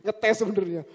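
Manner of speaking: ordinary delivery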